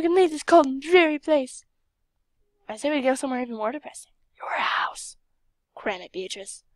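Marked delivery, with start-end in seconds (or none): 4.4-5.2 a sneaky whispered burn; whispered jab. Real, not acted. Roasting the game character, in a whisper. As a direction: slip in the insult under her breath.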